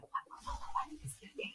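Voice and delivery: urgent, repeated whisper, retold; alarmed whisper. Daughter imitating mom asking if he's okay, alarmed whisper. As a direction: whisper it in a fluster, imitating a worried mother. Mother alarmed at husband's stare.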